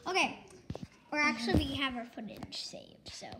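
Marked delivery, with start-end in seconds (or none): 1.7-3.3 whispering. Real, not acted. Aside about the footage.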